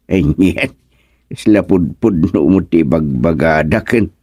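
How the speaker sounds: ordinary talk, nothing marked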